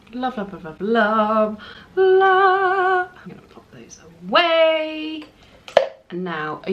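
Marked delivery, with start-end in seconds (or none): 0.1-3.1 building gush. Real, not acted. Beauty vlogger gushing over her eye look to viewers, building squeal. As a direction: gush louder and higher with each love.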